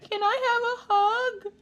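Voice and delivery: high, slow, mock-teary plea; whiny, tearful kid begging for a hug; guilt-tripping kid. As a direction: whimper it like a pitiful child. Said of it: "they gotta make you feel bad".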